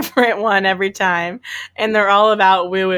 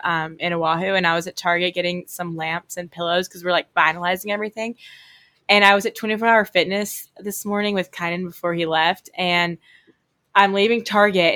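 ordinary talk, nothing marked

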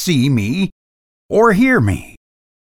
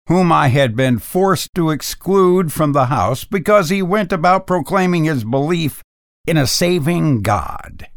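ordinary delivery

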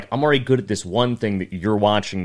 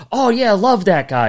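high, loud, gushing agreement; imitated enthusiasm; 11 semitones higher, 7 dB louder than usual. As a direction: mimic a comic delighted by a mutual friend. Acts comic's enthusiastic reply.

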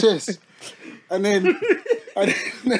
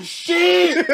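sudden high yelp of panic; shocked yell; 13 semitones higher, 6 dB louder than usual. Retold or imitated. Telling the story, yells at his own ruined hairline in shock. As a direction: blurt it out in shock.

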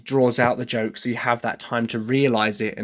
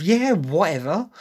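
put-on teenage attitude; 5 semitones higher than usual. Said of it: "throw an attitude in there".

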